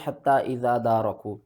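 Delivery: हत्ता इज़ा दारकू run through quickly, no doubling; हत्ता इज़ा दारकू in a quick, unstretched reading; common misreading. Shown as a demonstration. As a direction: say इज़ा with a single light consonant straight into दारकू. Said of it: "बहुत से लोग इसको पढ़ते हैं".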